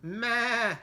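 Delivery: loud, high goat meh; playful meh; goat bleat. Acted. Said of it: "said Goat".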